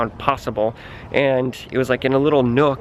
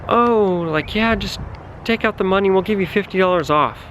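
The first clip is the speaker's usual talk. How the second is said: pushy salesy clerk; 6 semitones higher than usual. Retold or imitated. Towing staff coaxing her to pay cash, higher wheedling pitch. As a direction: sweet-talk them into paying cash.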